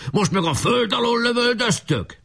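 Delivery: high, indignant battle yell; shouted complaint. A narrator's reading. Chief caught by fire from the trench, shouting. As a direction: cry out high at a hidden enemy. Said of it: "kiáltott".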